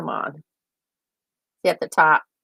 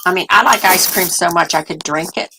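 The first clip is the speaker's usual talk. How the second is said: normal talking voice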